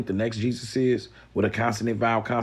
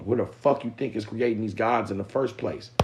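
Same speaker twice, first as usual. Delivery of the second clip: usual speaking voice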